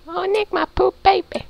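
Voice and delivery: high, fake wailing mother voice; hammed-up fake sob, very high; fake crying. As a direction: wail it like a mock-sobbing mom. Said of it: "Quit your fake crying".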